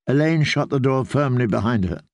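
ordinary delivery, nothing marked

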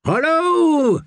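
high, far-carrying call of hullo. A narrator's reading. Nimble Dick calling out to find anyone hiding. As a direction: shout it into the empty ruin. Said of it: "called Nimble Dick".

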